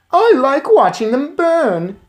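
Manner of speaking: put-on high, loud, slow smug boy; cruel boy. A narrator's reading. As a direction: savor it like a cruel kid. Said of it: "he said".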